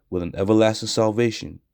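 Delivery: ordinary talk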